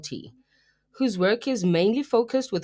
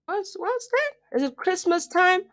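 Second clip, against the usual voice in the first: put-on high-pitched questioning voice; playful questioner; 10 semitones higher than usual. Host acting out a question to themself, in a high put-on voice. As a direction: ask it high and wondering, as a little skit. Plays a little mock dialogue with self.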